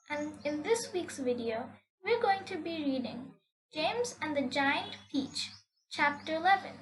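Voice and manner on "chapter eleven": ordinary delivery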